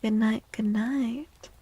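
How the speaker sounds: ordinary talk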